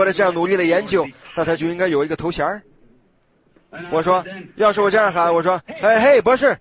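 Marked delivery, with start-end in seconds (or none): 5.8-6.6 brash call, a bit louder; rude hail. Shown as a demonstration. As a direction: holler at him without manners. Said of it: "听上去岂不是太粗鲁了吗".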